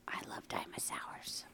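very soft, unvoiced whisper; hushed little confession; slow whisper. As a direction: whisper it slowly, as a little one. Playing little, whispering about dinosaurs.

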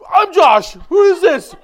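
high, booming mock outrage; comic imitation of an irate relative; worked-up brother-in-law. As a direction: shout down the phone, hammed-up indignation. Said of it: "he really got worked up".

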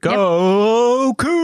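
cheer. Real, not acted. Cheering for alma mater.

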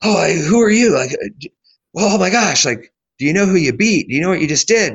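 breathless mock amazement, words spilling out; excited gushing. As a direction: imitate a race official bursting with excitement. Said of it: "so excited".

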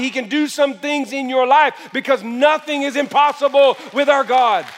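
loud, high preacher's cry to the church; rising sermon at full volume; full-force preaching. Acted.

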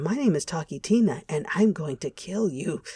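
quiet, calm creepy doll voice; a hint of a sinister talking doll; too calm creepy doll. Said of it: "voice threatens to kill him".

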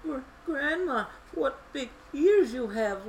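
put-on high, hesitant girl voice; nervous stammer. A narrator's reading. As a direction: trip on the first sound, girlishly. Nervous girl before disguised wolf.

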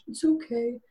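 small, soft brave face; imitated meek reassurance; crushed acceptance. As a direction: say it very softly, hiding disappointment. Crushed wife putting on brave face.

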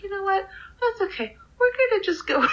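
holding it together with a tight brightness; strained cheerful. Retold or imitated. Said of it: "I was like".

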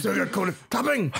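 high, over-emphasised imitation; Blade. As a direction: overdo the stress on each word. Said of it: "nobody talks like that".

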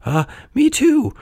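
very high, hesitant fib; lying puppet. A narrator's reading. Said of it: "lied Pinocchio".

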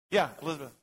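ordinary delivery

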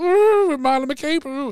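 high, drawn-out mock excitement; hammed-up imitation of a show-off; mocking woo. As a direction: whoop it slowly in a high mocking voice. Mocking the friend's boastful story.

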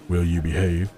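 low, mildly flat question. Acted. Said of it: "he asked flatly".

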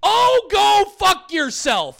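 very high, loud, slow yell; all caps shout. Shown as a demonstration. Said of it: "All caps on that one".

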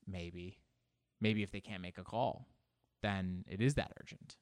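ordinary talk

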